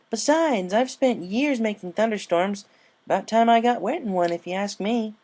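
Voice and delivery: high, bright tomboy pony voice; upbeat sporty pony, carefree; Rainbow Dash voice. A narrator's reading.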